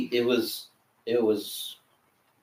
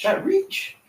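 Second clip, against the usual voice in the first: amazed; 3 dB louder than usual. Retold or imitated. Amazed reaction to tall man.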